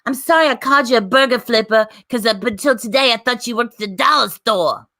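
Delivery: mock New York accent, lightly put on. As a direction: imitate a brash New York woman's accent. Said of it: "That accent".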